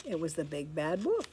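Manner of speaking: ordinary delivery, nothing marked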